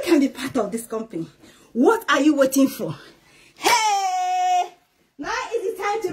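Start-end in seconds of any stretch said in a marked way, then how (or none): none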